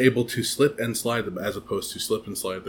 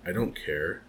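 low, much softer and dismissive; cold Aunt Petunia; 6 semitones lower, 10 dB quieter than usual. Retold or imitated. Petunia coldly dismissing young Harry. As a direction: brush the child off coldly. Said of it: "Petunia's just like".

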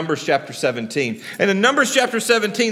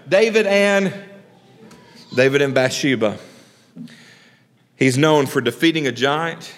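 normal talking voice

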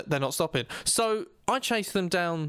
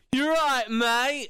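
higher, carefree greeting called out; oblivious bassist; 7 semitones higher, 3 dB louder, much slower than usual. Acted. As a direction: call a cheery hello as if nothing happened. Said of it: "the bass player opens the door going".

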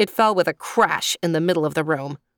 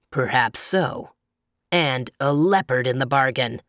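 growling bear; 4 semitones lower than usual. A narrator's reading. Bear considering eating the animals too, low growl. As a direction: growl it low, weighing the offer. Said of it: "growled the bear".

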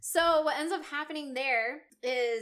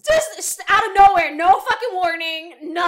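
much higher and louder, laughing in disbelief; excited outburst; 6 semitones higher, 14 dB louder than usual. Real, not acted. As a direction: shout it out, still can't believe the twist. Recapping shocking twist excitedly.

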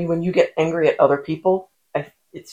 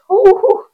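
teasing hoots; 7 dB louder than usual. Real, not acted. Teasing about potty mouth.

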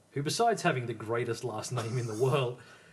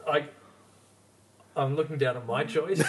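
normal talking voice

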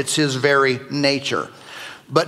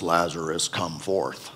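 much lower and softer, a deep command; preacher voicing Jesus at the tomb, deep and low; 9 semitones lower, 8 dB quieter than usual. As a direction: drop the voice way down and call him out of the tomb. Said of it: "I think he said it in a deep voice".

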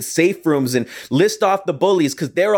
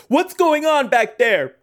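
driver calling out; 9 semitones higher, slower than usual. Retold or imitated. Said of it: "the driver called out over the speaker".

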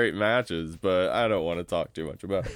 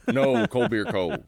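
stadium vendor hawking; 10 semitones higher than usual. Pretend. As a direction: belt it out like a stadium beer man. Repeats vendor call, higher and louder.

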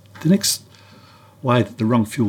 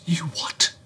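breathy disbelieving whisper of You what; stunned whisper; 5 semitones higher, 6 dB quieter than usual. Retold or imitated. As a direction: whisper it in shock. Stunned at friend's yacht news.

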